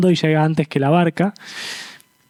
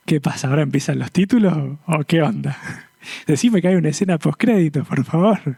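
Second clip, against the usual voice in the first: puzzled moviegoer. Retold or imitated. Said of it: "¿Vieron cuando dice".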